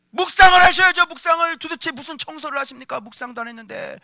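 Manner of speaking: 크게 시작해 잦아드는 잔소리 흉내; 배우자에게 따지듯 읊어대는 흉내. Retold or imitated. 배우자의 잔소리 재연. (따져대는 잔소리조)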